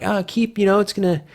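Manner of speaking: put-on high voice of a hungry fan; imitating eager fans. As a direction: lightly mimic fans begging for news. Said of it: "You got the people going like".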